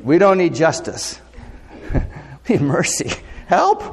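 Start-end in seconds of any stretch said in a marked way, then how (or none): 3.5-3.8 high pretend cry for help; a pretend "Help!" pitched high; mock cry for help. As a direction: call out like a drowning man. Crying out for rescue.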